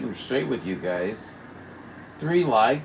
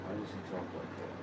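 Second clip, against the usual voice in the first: mock voice; 15 dB quieter, faster than usual. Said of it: "What's wrong with him?".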